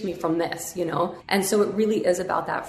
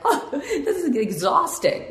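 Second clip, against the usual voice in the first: laughing; 9 semitones higher, slower than usual. Retold or imitated. Laughing at her own exhaustion.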